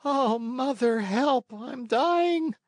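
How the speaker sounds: a struck schoolboy crying out before fainting. A narrator's reading. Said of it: "cried out faintly".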